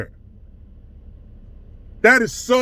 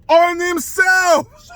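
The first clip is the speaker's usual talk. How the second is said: incredulous shout; 8 semitones higher, much slower than usual. Real, not acted. Marvelling at the manipulation.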